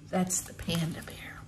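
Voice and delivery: soft whispered aside; whispering. Real, not acted. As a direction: whisper to the listener. Aside to child.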